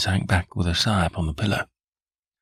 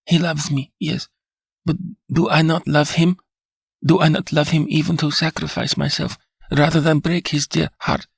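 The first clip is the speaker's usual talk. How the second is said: higher voice of a feverish wife pleading; a fevered wife insisting on her love; anguished pleading; 8 semitones higher than usual. A narrator's reading.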